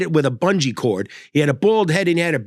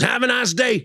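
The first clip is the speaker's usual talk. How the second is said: cheery trucker; 8 semitones higher than usual. Retold or imitated. Trucker leaving.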